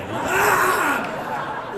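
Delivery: loud high scream; scream of pain. Acted. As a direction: shriek as if just stabbed. Stab victim acted.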